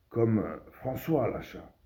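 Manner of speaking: usual speaking voice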